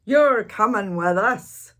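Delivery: loud pirate command; pirate order. Acted. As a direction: tell the boy firmly like a captain. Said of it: "Braidbeard told me".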